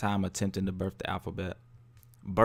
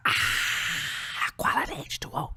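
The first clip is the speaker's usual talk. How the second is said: whispering; 7 semitones higher, slower than usual. Real, not acted. Sips water, whispers.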